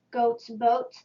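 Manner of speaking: ordinary delivery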